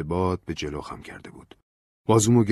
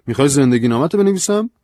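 incredulous; 9 semitones higher, 11 dB louder than usual. Acted. Incredulous question to Ben.

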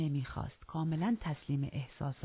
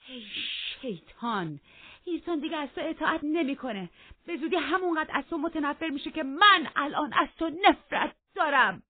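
high, loud, heated tirade; hateful outburst; 12 semitones higher, 10 dB louder than usual. Acted. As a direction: build to a loud declaration of hatred. Catherine curses Heathcliff in quarrel.

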